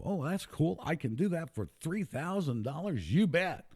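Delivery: high-pitched imitation of a gullible buyer; mock eagerness. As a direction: mimic someone jumping at a bargain. Mimics eager naive claim buyers.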